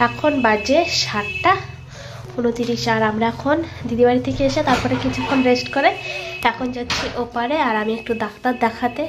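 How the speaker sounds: breathless, struggling for air; out of breath, hard to talk. Real, not acted. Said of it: "কথা বলতে পারছি না, নিঃশ্বাস নিতে পারছি না".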